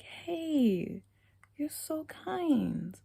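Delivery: eerie drawn-out whispered compliment; slow high whisper. Retold or imitated. Imitating the boy slowly whispering his compliment to her.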